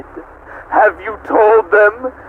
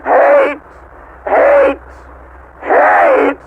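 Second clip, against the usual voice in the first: screamed hate; much slower than usual. Acted. Supercomputer screaming its hatred at the humans. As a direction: scream it out at them.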